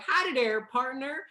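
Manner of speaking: high, hammed-up Texan greeting; Texas accent. Shown as a demonstration. Dialect coach showing how to say hello, mock Texas accent. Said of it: "the Texas accent is so beautiful".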